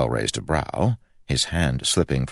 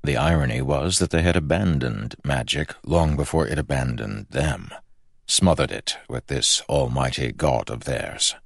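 plain reading voice

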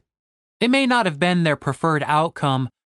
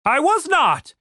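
high, indignant protest; insistent denial; 12 semitones higher, slower than usual. A narrator's reading. Captain Reynolds denies the hostage plan, insistent. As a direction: protest the accusation, pitch way up. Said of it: "insisted Captain Reynolds".

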